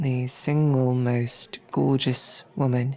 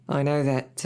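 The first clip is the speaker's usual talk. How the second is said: ordinary talk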